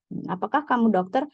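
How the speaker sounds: ordinary talk, nothing marked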